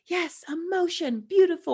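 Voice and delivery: mock gushing praise. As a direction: coo approval over the crying in a high voice. Mock cheering someone who cries.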